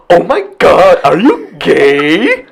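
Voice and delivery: high, drawn-out delighted gasp at a friend's crush; light teasing surprise, high and slow; playful excited gasp. Shown as a demonstration. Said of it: "obviously it's okay to".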